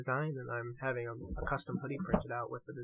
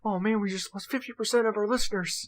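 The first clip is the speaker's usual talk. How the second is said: mock wail; 8 semitones higher, 7 dB louder than usual. Mock despair over losing listeners.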